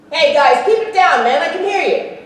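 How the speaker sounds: high, loud comic shush at the crowd; mock scolding. Real, not acted. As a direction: playfully tell the noisy guests off. Hushing chatty audience members jokingly.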